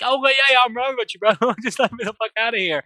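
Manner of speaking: high, loud rush of placating agreement; exasperated pleading with a laugh breaking in; frantic agreement. Retold or imitated. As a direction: blurt it out, desperate to be let go. Captive frantically agrees to be freed.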